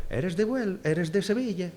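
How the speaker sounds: lightly mocked Galician questioning tune; Galician accent. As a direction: quote the Galician asking in their sing-song. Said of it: "hablaban así porque eran gallegos".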